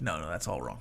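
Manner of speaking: low, quiet objecting voice; deep mock voice of the player; imagined player objecting. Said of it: "be like".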